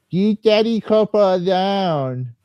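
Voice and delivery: high, drawn-out put-on plea; mock cheerleading voice. Mocking a won-over fan who defends the company, high put-on voice. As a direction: stretch it out high, hammed up.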